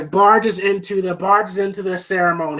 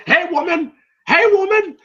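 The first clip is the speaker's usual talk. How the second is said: high-pitched mock holler; Mister T; 12 semitones higher than usual. Mister T calling to Adrian, mock holler. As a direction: call out rudely in a hammed-up impression.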